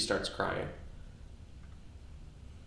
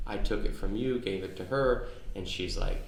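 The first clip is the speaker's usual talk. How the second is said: ordinary talk, nothing marked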